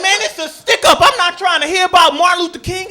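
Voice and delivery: loud, high, indignant outburst; annoyed robber. Acted. Robber to bank customer preaching at him, annoyed shout. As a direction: cut off the sermon with a shout.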